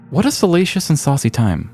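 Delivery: usual speaking voice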